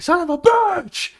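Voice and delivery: high-pitched, drawn-out pretend cursing; hammed-up table-flipping rage, squeaking high; mock angry outburst. Host imagines losing the recording, mock angry outburst. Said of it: "I would've got really mad".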